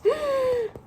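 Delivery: high startled gasp; sudden gasp of alarm; sharp gasp. Acted. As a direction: gasp in surprise. Gasp at eggs.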